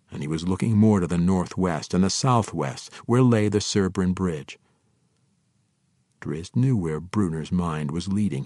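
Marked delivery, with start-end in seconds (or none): none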